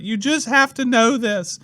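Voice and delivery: high-pitched, hammed-up weepy plea; mock crying. Church lady begging the class to understand, mock sobbing. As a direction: imitate someone wailing through tears. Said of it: "She starts crying".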